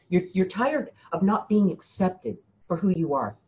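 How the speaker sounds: ordinary delivery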